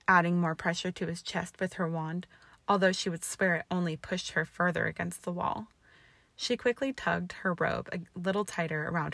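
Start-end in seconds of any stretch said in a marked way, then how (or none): none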